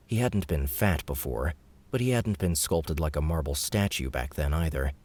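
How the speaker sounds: plain reading voice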